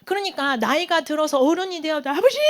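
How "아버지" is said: crying out. Retold or imitated. Grown son weeps to his father, crying out. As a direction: call out like a weeping grown child. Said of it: "이러면서 막 우는 거예요".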